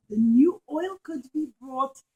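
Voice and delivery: usual speaking voice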